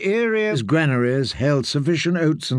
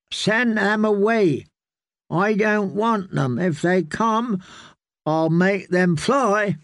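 put-on high, slow child voice making threats; a spoiled boy threatening guests; petulant boy; 6 semitones higher, slower than usual. A narrator's reading.

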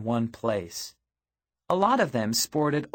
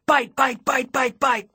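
high, rapid shouted chant; 10 semitones higher, 4 dB louder than usual. A narrator's reading. Narrator pouncing on a fallen rival vamp, shouted. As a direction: shout each word in quick, even bursts. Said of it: "leapt over to him and shouted".

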